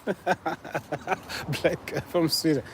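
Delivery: slow, soft, through a laugh; amused repeat. Real, not acted. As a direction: savour the silly guess with a chuckle. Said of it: "you guys are so funny".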